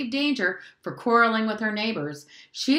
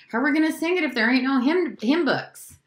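put-on fast, raised child's protest; indignant outburst; 6 semitones higher, 5 dB louder than usual. A narrator's reading. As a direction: blurt it out, unable to hold back. Child blurts out in church.

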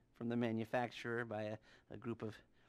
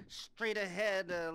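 ordinary talk

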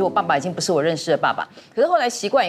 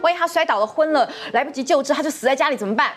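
rising angry scolding, faster as it builds; heated rant; 6 semitones higher than usual. Retold or imitated. 母女吵架重演. (又急又气地数落妈妈)

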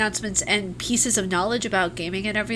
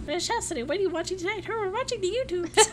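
put-on high old granny, laughing through it; chatty old granny at home; 10 semitones higher than usual. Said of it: "which I sound like an old granny".

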